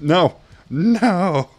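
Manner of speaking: loud, high yelled refusal; frustrated shout. Real, not acted. Gamer after walking off the edge, frustrated shout. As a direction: yell it in game rage, breaking into laughter.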